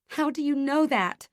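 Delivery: high, loud, tearful accusation; a sobbing reproach, voice breaking; accusing sob. A narrator's reading. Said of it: "My voice is a sob, accusing".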